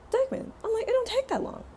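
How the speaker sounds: very high exasperated protest; exasperated squeak. Retold or imitated. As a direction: squeak it out in disbelief. Exasperated disbelief.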